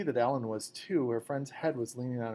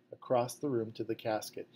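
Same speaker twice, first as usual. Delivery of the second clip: ordinary delivery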